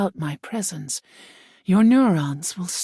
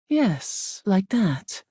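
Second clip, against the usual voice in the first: usual speaking voice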